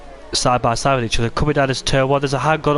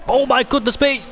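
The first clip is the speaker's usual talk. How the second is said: high sudden cry; real startled exclamation; surprised shout; 12 semitones higher than usual. Real, not acted. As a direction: blurt it as they nearly collide. Near crash in the race.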